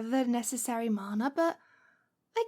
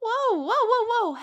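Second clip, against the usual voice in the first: startled whoa; 8 semitones higher, 8 dB louder than usual. Acted. Witch loudly stopping her struggling captive. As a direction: rein in a sudden outburst.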